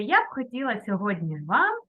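normal talking voice

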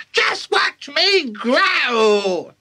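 very high, drawn-out screech; crowing grandma. Acted. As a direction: stretch every word in triumph. Grandma crowing while growing.

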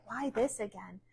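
soft mock complaint, under the breath; grumbling flesh. The flesh balking at a repeated message, quiet grumbling. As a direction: imitate a reluctant listener's quiet grumble. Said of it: "your flesh is like".

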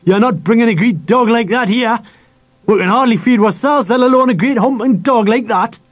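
high-pitched angry tirade; shouted. A narrator's reading. Dad refuses to feed another mouth, shouting angrily. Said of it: "shouted Dad".

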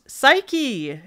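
distant call in Curly's voice. A narrator's reading. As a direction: call out as if from far off. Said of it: "in Curly's voice".

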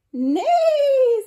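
loud, high imitated whinny; long high-pitched whinny; horse's neigh. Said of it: "said a horse".

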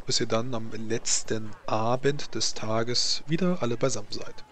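ordinary talk, nothing marked

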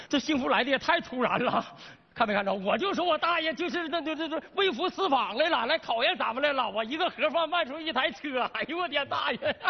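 high, excited gush with bursts of laughter; overjoyed. Acted. Salesman celebrating a surprise car sale, overjoyed. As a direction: gush in giddy disbelief at your luck.

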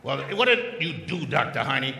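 ordinary talk, nothing marked

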